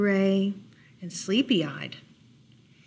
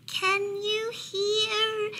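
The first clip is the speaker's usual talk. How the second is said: very high, slow, drawn-out little voice; tiny whispering mouse; 12 semitones higher, much slower than usual. A narrator's reading. Mouse testing Bear from under blankets, tiny high voice. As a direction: stretch it out thin and high, as if whispering. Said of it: "and whispered".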